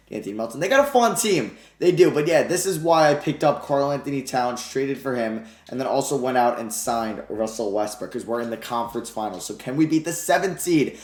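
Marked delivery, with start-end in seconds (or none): none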